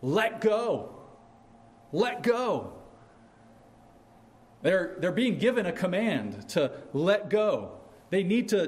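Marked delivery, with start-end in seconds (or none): 0.0-2.7 high, raised call to let go; mock yell. Someone yelling to a person on a cliff, mock yell. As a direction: call it out urgently from above. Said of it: "someone yells at them".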